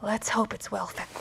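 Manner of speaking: soft grim whisper; whispered grim joke while hiding. Acted. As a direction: whisper it darkly.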